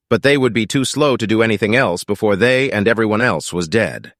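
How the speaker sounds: plain reading voice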